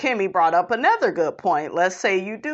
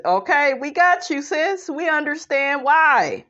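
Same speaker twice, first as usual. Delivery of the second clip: raised pitch, words stretched at the ends; put-on confessional voice; 5 semitones higher, 4 dB louder than usual. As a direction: act out a cast member's confessional. Voices cast member's confessional.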